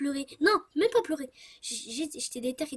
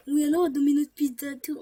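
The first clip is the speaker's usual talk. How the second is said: mock caller voice; 2 semitones higher, 5 dB louder than usual. Prank call, fake caller voice.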